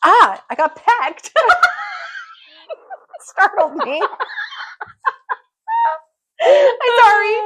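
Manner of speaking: surprised yelp into laughter; startled laugh. Real, not acted. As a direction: jump and laugh at a sudden peck. Startled by a bird peck.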